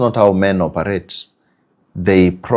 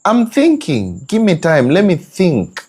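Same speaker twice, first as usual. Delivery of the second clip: defensive man; 9 semitones higher than usual. Retold or imitated. Man pushing back at his wife, high protest. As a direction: fend her off, pitched way up. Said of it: "you keep on saying".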